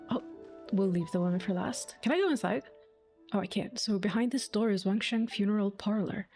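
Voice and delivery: ordinary talk, nothing marked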